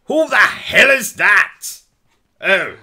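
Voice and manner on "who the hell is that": loud yell at the door; angry shout. Acted. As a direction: shout at the intruder. Someone comes home.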